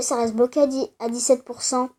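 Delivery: ordinary talk, nothing marked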